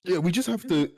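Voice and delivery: words jolted by hiccups; hiccuping. Real, not acted. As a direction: push through the hiccups. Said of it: "why have I got hiccups now".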